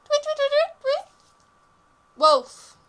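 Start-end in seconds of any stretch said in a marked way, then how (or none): 0.0-1.1 high, louder chirping tweets; bird tweet. Pretend.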